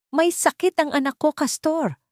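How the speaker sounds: high, tearful cry of a line; sobbing plea. A narrator's reading. Mother sobbing to her child's father about the sick child. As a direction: break down as you beg him. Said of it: "Humagulgol si Aluncina".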